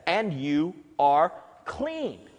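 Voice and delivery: clean drawn out slowly; slow emphatic quote. Retold or imitated. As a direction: lay each word down slowly, with weight. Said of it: "That's why Jesus says".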